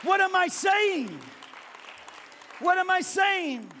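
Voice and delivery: very high, loud exclamations; preacher overcome by his own story; overwhelmed. Real, not acted. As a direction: cry it out, carried away. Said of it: "preacher overwhelmed".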